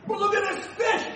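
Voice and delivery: high-pitched, thrilled boast; eager retold exclamation; excited showing off. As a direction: brush it off and show the catch. Neighbor brushes off injury, boasts.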